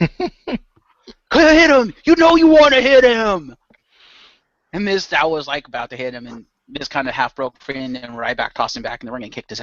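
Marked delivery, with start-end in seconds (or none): 0.0-3.5 high, loud acted ringside yell; drawn-out, high-pitched urging at full volume; goading shout. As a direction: yell it like you're egging on a wrestler. Acting out ringside goading of Mizdow.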